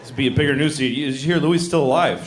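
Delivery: ordinary talk, nothing marked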